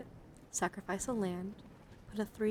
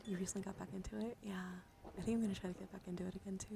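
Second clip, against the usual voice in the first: fast, breathy ASMR whisper; soft quick ASMR whisper to chat; 4 dB quieter, much faster than usual. Acted. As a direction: whisper it quickly, close to the mic.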